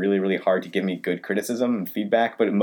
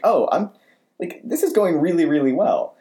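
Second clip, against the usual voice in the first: retold excitement, pitched high; excited; 8 semitones higher than usual. Telling of his comments landing in class, excited. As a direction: replay your own excited thought, higher. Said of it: "I'm, like, really excited".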